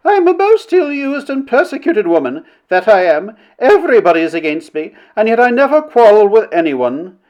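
high, wailing self-pity; hysterical complaint. A narrator's reading. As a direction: lament as if on the edge of sobs. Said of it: "between hysterical sobs she managed to stammer".

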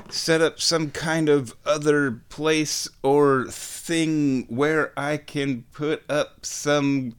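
ordinary delivery

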